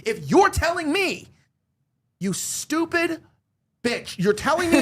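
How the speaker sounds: high, slow, furious; venting real anger at Avi; angry outburst. Real, not acted. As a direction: let real anger break through on you stupid bitch. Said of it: "hear the anger in my voice".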